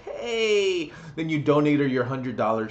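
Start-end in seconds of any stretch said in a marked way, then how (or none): 0.0-0.9 high, cutesy put-on hey; mock streamer girl.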